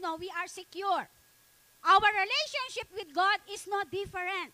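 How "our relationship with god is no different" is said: high, loud preacher's call to the congregation; fervent preaching. Acted. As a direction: preach it at full force, rising. Preaching at full force. (masigasig na pangangaral nang malakas)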